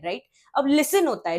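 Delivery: normal talking voice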